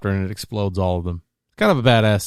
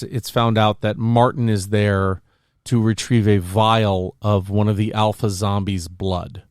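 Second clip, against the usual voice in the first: ordinary talk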